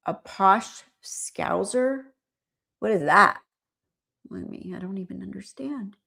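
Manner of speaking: usual speaking voice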